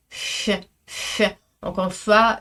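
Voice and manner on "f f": unvoiced puff of air on f; breathed soft f. Shown as a demonstration. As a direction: blow out a soft f. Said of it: "j'émets un souffle quand je fais верфь". (un f soufflé, sans voix)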